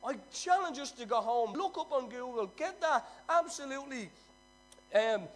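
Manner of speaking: preaching. Acted. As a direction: preach it with full fire. Preaching at full force.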